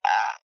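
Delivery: low drawn-out swoon ah; melting at a cute moment; swooning sigh. Retold or imitated. Fan reacts to hand-holding, low swooning ah. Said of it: "I'm just like".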